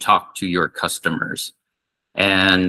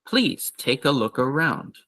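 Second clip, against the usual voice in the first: quicker, natural invitation; faster natural read; 4 semitones higher than usual. Shown as a demonstration. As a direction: say it at normal speed. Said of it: "so faster it would be".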